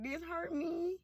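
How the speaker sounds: very soft, high little plea; acted timid confession; small hurt voice. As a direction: barely say it, high and wounded. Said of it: "if I be like".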